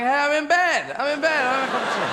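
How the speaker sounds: high, loud comic whine of protest; lazy eye protesting. Acted. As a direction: play a lazy eye refusing to get up. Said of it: "Another eye is going".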